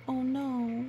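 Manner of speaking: usual speaking voice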